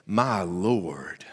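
deep, hushed preacher's interjection; low, awed murmur in a friend's deep voice; Vance Ross. Retold or imitated. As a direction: imitate a deep-voiced pastor talking back, low and breathy. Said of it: "his voice is deep, resonant".

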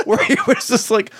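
ordinary talk

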